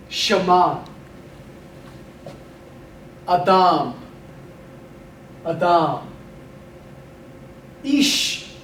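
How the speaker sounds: teacher models for the class to repeat; vigorous pronunciation drill. Shown as a demonstration. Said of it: "Say it with vigor and articulation".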